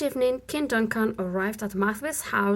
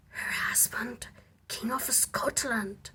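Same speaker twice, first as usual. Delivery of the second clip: breathy secret whisper, much softer; a secret ambitious whisper; whispered thought; 7 dB quieter than usual. Acted. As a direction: hush it like a private dream. Lady Macbeth's secret thought.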